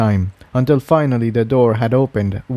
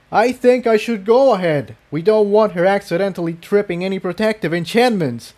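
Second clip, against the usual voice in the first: higher, earnest stallion calling back; worried Jigsaw; 8 semitones higher than usual. Acted. Jigsaw calling back to Tiptoe while galloping, worried. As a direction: hurry ahead, fretting about traps.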